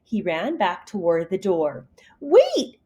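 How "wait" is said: high call of wait; urgent call; cry out. Acted. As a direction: stop him with a cry. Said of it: "Kitten cried".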